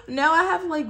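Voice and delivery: high, loud burst of laughter into words; laughing. Real, not acted. Vlogger laughing at too many duplicates, high laughing. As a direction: laugh at your own bad luck.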